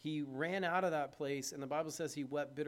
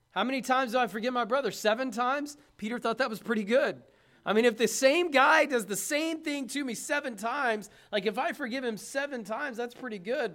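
mock Peter; 6 semitones higher, 7 dB louder than usual. Preacher voicing Peter's smug count.

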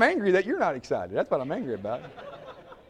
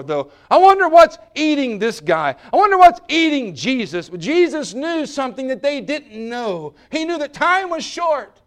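loud, higher, intense pulpit delivery; thundering sermon climax; preaching at full force; 10 semitones higher, 11 dB louder than usual. Acted. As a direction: drive it home with urgency.

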